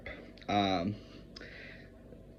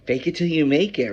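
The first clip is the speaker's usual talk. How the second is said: higher, louder mock cheer; pretend happy; 6 semitones higher, 6 dB louder than usual. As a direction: put on a show of happiness. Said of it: "time to pretend to be happy".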